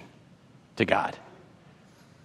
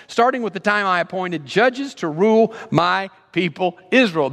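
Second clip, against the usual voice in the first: preaching God's voice; 6 semitones higher than usual. Acted. Preacher voicing God answering David, emphatic. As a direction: declare it with rising preacher force.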